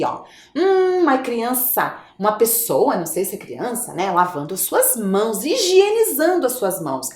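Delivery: ordinary talk, nothing marked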